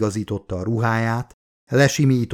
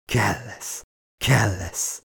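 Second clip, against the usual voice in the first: understated gasped urging; a touch of breathless need, held back; narrator's mild suggestion of panting; lightly panted; 3 dB quieter, much slower than usual. A narrator's reading. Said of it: "ezt ziháltam".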